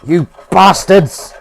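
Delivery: furious shout. Real, not acted. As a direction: bellow it slowly at the ruined pan. Cursing burnt pan residue.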